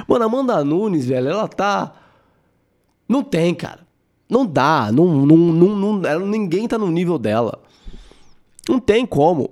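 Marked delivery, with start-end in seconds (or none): none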